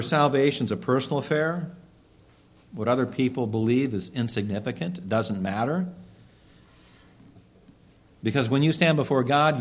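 ordinary talk, nothing marked

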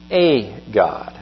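"a" set apart after a pause, said as a full "ay"; stress on "a". Retold or imitated. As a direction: lean on "a" as a separate word before "God". Quotes disputed translation, isolating the article.

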